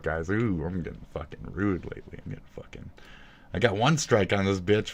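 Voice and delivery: normal talking voice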